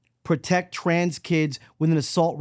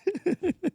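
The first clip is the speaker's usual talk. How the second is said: high giggle; 10 semitones higher, 5 dB quieter than usual. Pretend. Laugh inside the mom bit.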